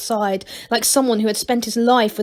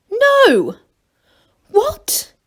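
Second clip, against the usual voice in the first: high startled squeak; shocked cry; 13 semitones higher, 5 dB louder than usual. Acted. Gnomes crying out in high shock. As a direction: react in disbelief, high.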